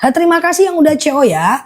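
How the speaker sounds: ordinary talk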